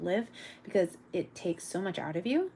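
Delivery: normal talking voice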